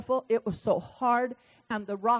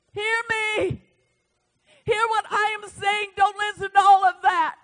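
higher, then loud commanding call; God's urgent call; 9 semitones higher, 6 dB louder than usual. Acted. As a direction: summon them to listen. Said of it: "I get this word".

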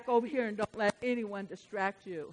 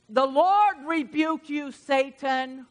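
slow, louder, solemn rebuke; God rebuking Satan; 6 semitones higher, 8 dB louder, much slower than usual. Acted. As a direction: pronounce it like God's judgment, slowly. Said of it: "the Lord said".

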